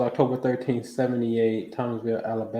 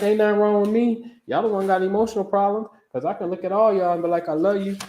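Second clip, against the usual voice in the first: higher, fast playful protest; defiant comeback; 7 semitones higher, faster than usual. Retold or imitated. Dad answering his daughter, higher and fast. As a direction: toss the comeback right back at her. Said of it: "And I'm like".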